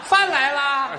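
high, loud cheerful call; hammed-up delivery call; delivery boy call. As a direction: announce the food brightly at the door. Delivery boy announcing food.

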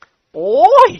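long high loud "โอ้ย" wail; drawn-out wail. A narrator's reading. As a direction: let out a long frightened howl. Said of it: "เจ้าแห้วร้องคาง". (แห้วร้องโอ้ยยาวด้วยความกลัว)

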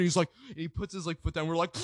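usual speaking voice

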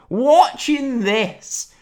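stretched incredulous echo; drawn-out disbelief. Real, not acted. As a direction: drag the words out in mock despair.

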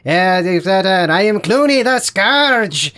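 put-on slurred French accent. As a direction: drawl the words in a sloppy French accent. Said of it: "French, but also kind of, like, slurring his words".

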